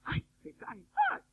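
high-pitched startled squeal, played up; mock fright. Preacher mimics newcomers frightened that people fall, high mock fright. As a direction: imitate someone scared by the falling. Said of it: "Se asustan que se caen".

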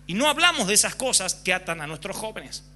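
ordinary talk

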